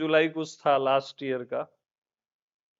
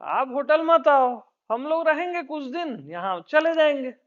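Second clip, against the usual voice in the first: higher, coaxing mock visitor voice; mock visitors' voice; 7 semitones higher than usual. Guests telling the guru to stay away, in a higher coaxing voice. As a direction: lightly imitate the guests talking back. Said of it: "बोले".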